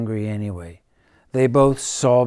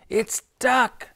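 much higher excited cry; joyful shriek; 12 semitones higher than usual. Acted. The friends shriek happily on seeing the duck. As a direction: burst out with delight. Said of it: "they shrieked".

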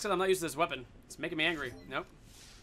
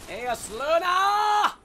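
loud, high, stretched-out call; long-held loud gamer yell; drawn-out yell; 8 semitones higher, 5 dB louder, much slower than usual. Real, not acted. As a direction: holler it slowly, rising high. Calling out to game weapon.

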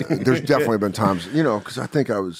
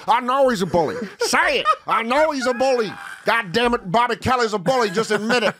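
higher-pitched mock angry insisting; hammed-up angry demand to confess; angry Keith; 9 semitones higher than usual. Comic Keith pressing him angrily to admit it. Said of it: "And Keith is getting angry".

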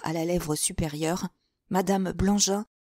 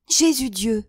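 exclaiming; 5 semitones higher, 5 dB louder than usual. Acted. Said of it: "s'écria la geôlière".

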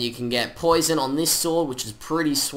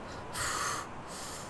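long breathy exhale; sigh; 13 dB quieter than usual. Real, not acted. Gamer offered a gift, long soft sigh. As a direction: let out a soft, voiceless sigh.

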